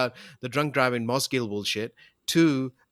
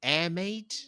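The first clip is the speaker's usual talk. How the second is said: put-on Kiwi accent; 4 semitones higher, much slower than usual. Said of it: "You're saying it with a Kiwi accent now".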